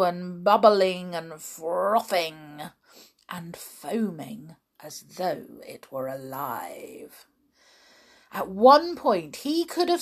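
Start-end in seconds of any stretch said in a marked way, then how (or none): 3.3-7.3 soft hushed whisper. A narrator's reading. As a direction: breathe the words in awe. Whispered narration.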